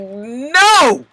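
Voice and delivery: high, drawn-out mock "no"; mock disbelief. Reacting to the news about daddy, hammed-up disbelief. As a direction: refuse it high and incredulous. Acting out refusal to believe it.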